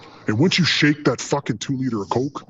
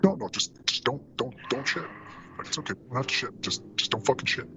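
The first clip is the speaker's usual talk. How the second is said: softer, hushed begging; hushed pleading; 9 dB quieter than usual. Retold or imitated. Medic begging the patient not to go, hushed plea. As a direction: plead quietly, urgently.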